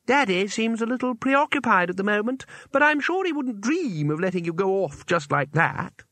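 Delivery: a deranged girl pretending politeness; sweetly menacing girl. A narrator's reading. Isabel speaks.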